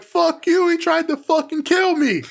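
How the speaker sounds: high, shouted accusation; mock outburst. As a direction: yell it at Regal, outraged. Voicing Cole to Regal.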